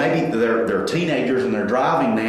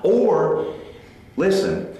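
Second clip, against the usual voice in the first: usual speaking voice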